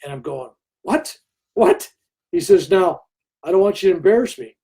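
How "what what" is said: stunned disbelief. Retold or imitated. Stunned by guru's phone message.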